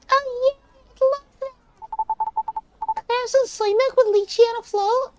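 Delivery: put-on excited high-pitched child; loud, very high nine-year-old; kid voice. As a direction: squeal it loudly like a little kid. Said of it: "I'm reading in this kid voice".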